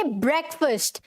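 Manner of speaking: ब्रेकफास्ट as "BREK-fust"; short e and reduced second vowel in ब्रेकफास्ट; correct pronunciation. Shown as a demonstration. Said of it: "ब्रेकफास्ट नहीं".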